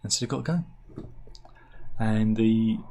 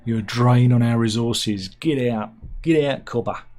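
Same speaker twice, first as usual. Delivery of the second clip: loud mocking Aussie voice, mildly accented; mock Australian official; 6 dB louder than usual. Mocking Australians telling migrants get out, loud mock Aussie accent. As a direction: bark it out like a rude Aussie border guard. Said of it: "They say".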